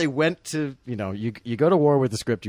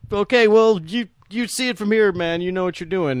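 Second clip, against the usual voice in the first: mock deference; 8 semitones higher, 4 dB louder than usual. Mock public deference to president.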